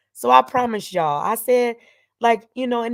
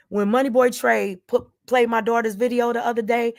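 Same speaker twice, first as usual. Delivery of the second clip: ordinary talk, nothing marked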